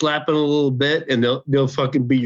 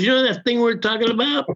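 coy high mock voice; 10 semitones higher than usual. Quoting her coy question.

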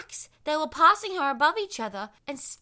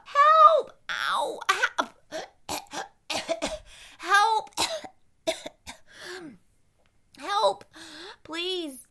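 calling for help; 6 semitones higher, 5 dB louder, much slower than usual. Acted. Evie swept down rapids.

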